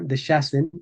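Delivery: Deshasen as de-SHA-sen; medieval pronunciation. Shown as a demonstration. Teacher repeating an example, medieval sh pronunciation. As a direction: say Deshasen with a clear sh. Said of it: "el sonido sh".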